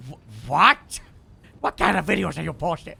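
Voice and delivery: loud startled what; surprised. Real, not acted. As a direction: caught off guard, loud. Friend posts odd video.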